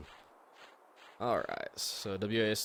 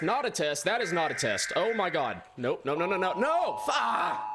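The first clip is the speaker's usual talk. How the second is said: panicked, high and loud; rising panic into a scream; being chased; 8 semitones higher, 4 dB louder than usual. Real, not acted.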